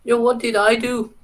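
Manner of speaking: high, loud, indignant yelp; startled protest. Real, not acted. Streamer caught off guard by something in chat, yelping in startled protest. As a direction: jump up in surprised protest.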